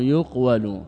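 "يقول" slowly as yuq-walu; underlying-form pronunciation. Shown as a demonstration. Teacher giving the origin of yuqal, "يقول" slow as yuq-walu. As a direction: close on the q, then walu in "يقول". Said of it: "يقال أصل يقول".

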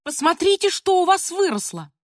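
high indignant cry dropping onto the insults; acted angry tirade; grandmother shouting. A narrator's reading. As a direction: scold loudly as an outraged grandmother. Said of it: "кричала бабушка".

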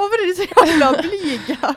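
high, loud, drawn-out complaint; mock exasperation. Recruiter after the boys walk off, mock-exasperated and high. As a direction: wail it slowly, half laughing.

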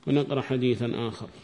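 normal talking voice

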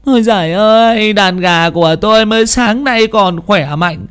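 high wailing lament; keen over the dead chickens; collapsed wife laments her dead hens, wailing high. Acted. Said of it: "khuỵu xuống đất mà khóc lóc".

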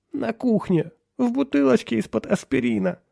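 very high tearful wife. A narrator's reading. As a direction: answer in a high voice while wiping away tears. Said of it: "ответила миссис Дин, вытирая слёзы". (высоко, сквозь слёзы)

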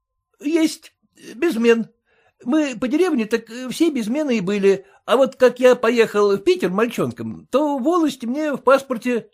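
bow and scrape to the master; humble clerk. A narrator's reading. Clerk's voice in story.

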